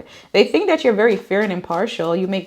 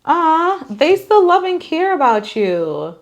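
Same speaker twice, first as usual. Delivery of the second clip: higher, louder happy Ah; bright reveal; 9 semitones higher, 5 dB louder, slower than usual. Real, not acted. Reader telling pile two their person still loves them, higher and louder. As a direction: lift up with joy at the card.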